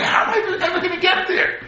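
high-pitched fretting with a laugh; anxious question. Retold or imitated. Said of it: "when he's holding in the beginning".

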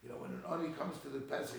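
usual speaking voice

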